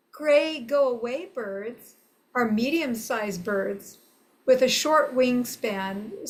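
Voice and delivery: ordinary talk, nothing marked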